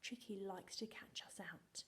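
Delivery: whispered like a secret; secretive whisper. Shown as a demonstration. Teacher whispering about the trick to the class. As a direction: hush it, sharing a secret.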